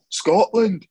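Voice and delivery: loud, high Scottish place-name; mock Scottish accent. Mocking people who only name the place, Scottish accent. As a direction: exaggerate a Scottish accent on one word. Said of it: "say the place in that accent".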